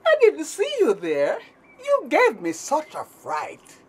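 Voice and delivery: plain reading voice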